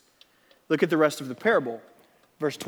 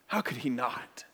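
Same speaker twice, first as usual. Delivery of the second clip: softer, hushed question; quiet; 5 semitones lower, 8 dB quieter than usual. Acted. Preacher softly echoing the question to the congregation. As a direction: let it hang quietly.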